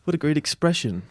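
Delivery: softly awed, high-pitched audience voice; mock admiration. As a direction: gush quietly, imitating an easily impressed crowd. Imitating an overly accepting audience.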